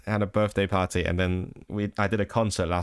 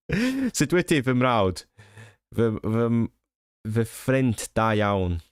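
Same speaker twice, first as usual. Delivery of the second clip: slow, slightly South Walian Welsh; southern Welsh accent; slower than usual. Real, not acted. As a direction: speak Welsh with a light southern Walian lilt. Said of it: "slightly southern Wa-, uh, Walian accent".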